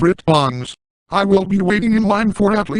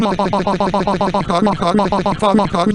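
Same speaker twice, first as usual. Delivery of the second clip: bursting into a rapid cackling laugh; real cackling laugh; 2 semitones higher than usual. Real, not acted. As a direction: cackle at your own slip-up. Laughing at own mistake.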